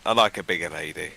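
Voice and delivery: low flirty purr. Acted. Nicholas flirting with the large lady, low and seductive. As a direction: purr it low to tempt her.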